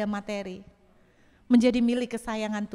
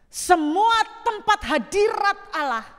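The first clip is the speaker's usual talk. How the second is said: preaching emphasis; 7 semitones higher, 5 dB louder, slower than usual. Real, not acted. Preaching at full force.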